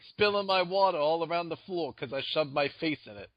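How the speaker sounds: a hint of a Brooklyn tough guy; Brooklyn accent. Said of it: "has a Brooklyn accent".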